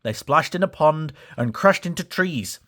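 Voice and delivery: ordinary delivery, nothing marked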